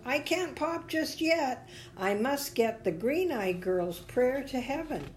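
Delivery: high, earnest character voice; determined little bubble. A narrator's reading. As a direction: insist in a small high voice. Bubble pleads his mission in story.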